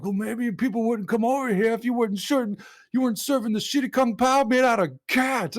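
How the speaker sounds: high-pitched cartoon Japanese accent; needling taunt in exaggerated Japanese accent; mock Japanese accent. Rival insulting the owner's food, mock Japanese accent. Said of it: "the Japanese dude".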